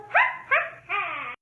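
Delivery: high, loud cackling witch laugh; hammed-up witch cackle. Shown as a demonstration. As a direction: cackle like a witch on her broom. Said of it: "The witch on her broom goes".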